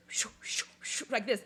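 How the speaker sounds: soft breathy whoosh, repeated; imitating a spinning vortex; whooshing vortex sound. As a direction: push air out to mimic a swirling vortex. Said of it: "and it's going like this".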